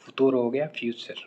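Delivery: ordinary talk, nothing marked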